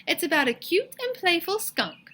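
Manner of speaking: high, sing-song storytime lilt; sweet teacher voice. A narrator's reading. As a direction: sell the cute skunk to the class.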